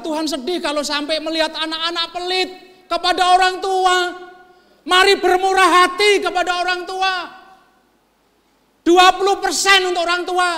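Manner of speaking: high, loud pulpit exhortation; preaching at full force. Acted. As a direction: preach it hard to the congregation.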